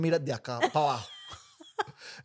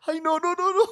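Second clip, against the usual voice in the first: high, breathless laughing protest; 13 semitones higher than usual. Real, not acted. Host laughing at her own story, breathless.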